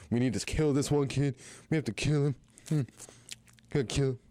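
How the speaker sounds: put-on fat kid. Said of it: "be like".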